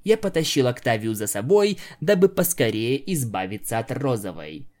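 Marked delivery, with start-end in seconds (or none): none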